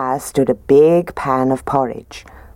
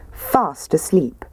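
plain reading voice

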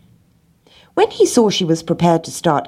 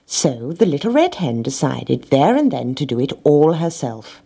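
usual speaking voice

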